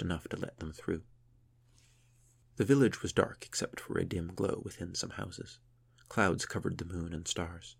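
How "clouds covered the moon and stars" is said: usual speaking voice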